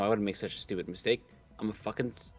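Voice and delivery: normal talking voice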